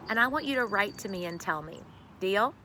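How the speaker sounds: plain reading voice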